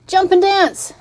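high, loud rooster voice; rooster calling out. A narrator's reading. Rooster advising the fleeing pig, high loud call. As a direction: crow the advice out high. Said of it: "said the rooster".

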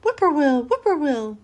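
high, falling whippoorwill cry; bird call. A narrator's reading. A bird calls from across the road, in singsong. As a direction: call it out twice like a singing bird. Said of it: "a bird sang".

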